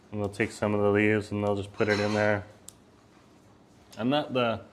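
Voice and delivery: normal talking voice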